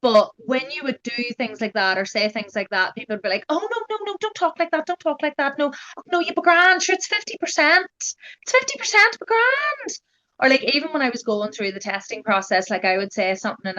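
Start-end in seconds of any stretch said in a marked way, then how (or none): 3.5-10.0 high-pitched, insistent shushing of someone's worries; dismissive reassurance. Retold or imitated. People cutting off her planning ahead, high dismissive reassurance. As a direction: mimic well-meaning people brushing it off, voice way up.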